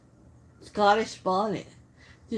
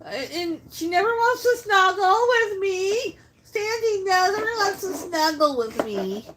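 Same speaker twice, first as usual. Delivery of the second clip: higher, whiny pretend sulk; mock whine; 7 semitones higher than usual. Complaining that Sandy never snuggles with her, higher mock whine. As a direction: sulk like a jilted child, half-laughing. Playful complaint about the dog.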